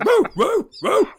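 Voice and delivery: high mock barking woo woo; imitated dog bark. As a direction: howl like the dog losing it.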